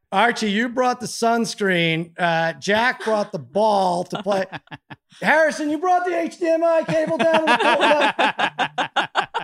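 mock-furious roll call that cracks into laughter; sarcastic exasperated yell. Dad sarcastically listing what each kid brought to the pool, rising to a laughing shout.

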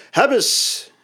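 high triumphant call; ringing cry. A narrator's reading. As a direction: let it ring out. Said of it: "galmt zijn stem in het atrium".

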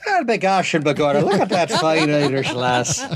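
put-on hammy Irish leprechaun accent; jolly Irish brogue, played for laughs. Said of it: "I couldn't possibly talk the whole time like this".

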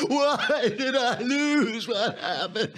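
loud, high, drawn-out mock wail; hammed-up lament of a sore loser; Hillary Clinton. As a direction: bawl it in mock despair, stretching the words. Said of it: "read it in the right tone of voice".

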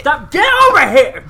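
high, loud playful yell; hammed-up angry shout; mock fury. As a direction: order them over in pretend rage. Mock quarrel, ordering the other over.

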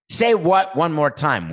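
high, slow dare; movie line quote. Acted. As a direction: quote the threat with every word leaned on. Quoting iconic Pulp Fiction line.

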